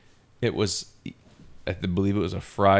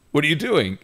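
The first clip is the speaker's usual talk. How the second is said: higher, demanding mock boss; 8 semitones higher, 4 dB louder than usual. Boss confronting a teenage dishwasher, demanding. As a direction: challenge the kid as an irritated boss.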